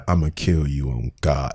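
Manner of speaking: mock menacing murmur; imitating a menacing tone. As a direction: mimic the chilling delivery, low and soft. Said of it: "with that voice at that tone".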